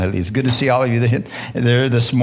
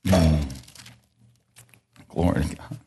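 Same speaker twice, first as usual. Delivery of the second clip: awed whisper; 9 semitones lower, 6 dB quieter than usual. Real, not acted. Pastor alone at the pulpit, overwhelmed, whispering. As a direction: whisper it, overcome with awe. Pastor overcome before the sermon.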